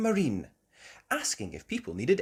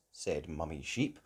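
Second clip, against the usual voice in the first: usual speaking voice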